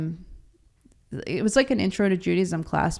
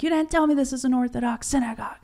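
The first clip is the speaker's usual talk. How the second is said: higher, fast, indignant complaint; a mock furious husband snapping at his wife; 7 semitones higher, faster than usual. Husband scolding wife after the synagogue, mock furious. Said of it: "Daniel was furious".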